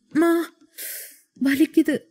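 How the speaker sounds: sudden high cry of pain. A narrator's reading. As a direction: yelp it out in pain. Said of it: "என்றாள்". (வலியில் உயர்ந்த அலறல்)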